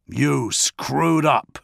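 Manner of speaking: slow, cutting snap at a subordinate; drawn-out scolding snap; snapped. A narrator's reading. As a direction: bite off each word with scorn. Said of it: "he snapped".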